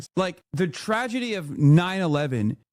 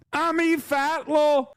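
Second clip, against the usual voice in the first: much higher, slow mock Middle Eastern accent; 9 semitones higher, slower than usual. Creator repeating her name to mock accent critics, high and slow. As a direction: stretch "E Fartlaw" out, hammed-up Middle Eastern accent. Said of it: "organic Middle Eastern accent".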